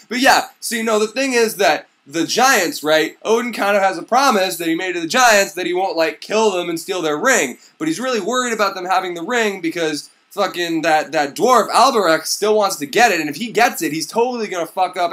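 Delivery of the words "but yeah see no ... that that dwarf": ordinary talk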